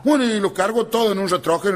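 ordinary talk

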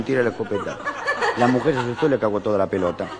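ordinary talk, nothing marked

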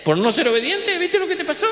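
scolding parent. Acted. Scolding child in a joke.

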